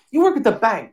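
high-pitched retold guess; imitating the cashier. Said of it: "she goes like this".

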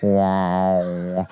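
deadpan deep wow like a crowd; mock awed wow. Comic drops a deep booming wow.

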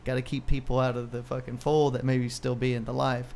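normal talking voice

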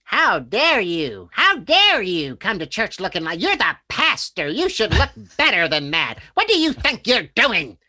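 put-on high, loud indignant old lady scolding. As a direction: wag a finger at the pastor, shrill with outrage. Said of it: "She stuck her finger in my face and said".

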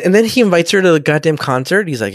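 ordinary delivery

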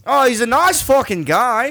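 high, loud Aussie exclamation; Australian accent. Shown as a demonstration. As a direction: praise him like a cheery Australian. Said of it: "good Australian accent".

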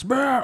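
high rolling war cry; raging battle roar. Acted. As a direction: roll out a berserk battle trill. Raging barbarian attack cry.

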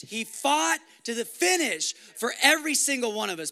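rousing preacher at full force; full-force preaching. Acted. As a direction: hammer the victory home. Sermon climax, loud.

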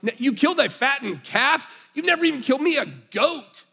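put-on sulky older brother complaining to his father; teenage angsty voice. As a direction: rant at dad like a resentful teenager. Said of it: "access my inner, like, teenage angsty voice right now".

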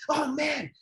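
high-pitched, thrilled outburst on spotting a favorite fighter; excited dad. Retold or imitated. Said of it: "he was all excited".

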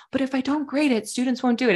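skeptical teacher objection. Retold or imitated. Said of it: "the question you hear the most".

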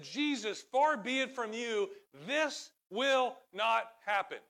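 loud, high-pitched rebuke; Peter. Acted. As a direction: forbid it firmly, slowing on the final words. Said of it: "He rebukes Jesus".

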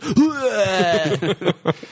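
high mock puking noise; hammed-up heave, half laughing; imitated retching. Classmates mimicking the kid who puked, imitated retching. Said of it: "this kid was like".

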